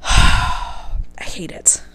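slow whispered sigh. Real, not acted. Done ranting about the suitor, a slow whispered sigh. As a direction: breathe it out, defeated.

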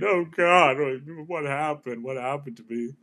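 mildly voiced regret on a deathbed; dismayed dying man. Imagined man on his deathbed regrets his life, mild dismay. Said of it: "sitting on his deathbed thinking".

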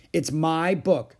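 loud, high, slow declaration; proud, drawn-out claim; announcing. A narrator's reading. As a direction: announce it like a possessive child. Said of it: "she announced".

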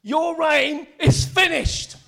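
high, loud triumphant preaching, easing in volume at the end; shouting preacher. Real, not acted. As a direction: shout out the victory, drawing out the words. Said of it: "Sorry, I'm shouting".

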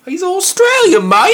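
very high, loud, drawn-out Australian twang; hammed-up, squawked Australian accent; mock Aussie accent. Co-host corrects him to Australian, hammed-up high Aussie accent.

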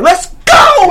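high, loud whoop; a loud, high, mock cheer; thrilled yell. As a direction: yell it like at the top of a ride. Describing the intense high.

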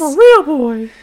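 playful Pinocchio quote; mock Pinocchio.

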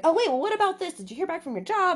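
high, bright questions; eager interest. Retold or imitated. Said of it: "and I'm like".

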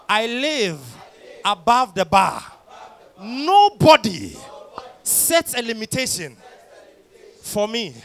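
loud, slow preacher proclamation; preaching declaration. Acted. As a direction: proclaim each phrase to the crowd. Leads loud faith declaration.

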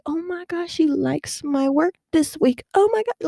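high squealing disbelief; giddy excitement. Retold or imitated. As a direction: gush like you just got praised. Thrilled inner reaction retold.

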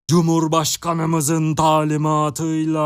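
high, slow bellow with the last vowel held long; drawn-out shout. A narrator's reading. Electrified minister bellowing from the stretcher, long drawn-out shout. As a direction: shout it at length on one sustained high pitch. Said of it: "diye bağırdı bakan".

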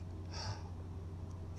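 slow unvoiced breath out; long audible exhale. Shown as a demonstration. Host demonstrating the exhale for listeners, slow breath out. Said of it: "take a deep breath in".

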